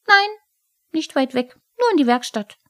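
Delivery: lightly voiced sulky kobold; huffy kobold. A narrator's reading. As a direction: correct it in a small huff.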